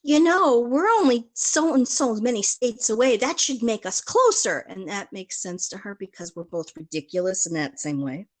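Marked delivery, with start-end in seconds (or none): 0.0-4.7 higher and louder, gushing with mock logic; retold eager pitch. Telling her friend how close their states are, higher, louder, playful. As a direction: sell the silly idea with playful excitement. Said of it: "But I was saying to her".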